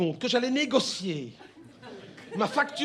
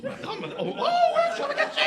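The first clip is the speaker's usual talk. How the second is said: very high little calling voice; high-pitched put-on voice interrupting; voice from the phone. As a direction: pipe up high like a caller in a receiver. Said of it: "à un moment, j'entends".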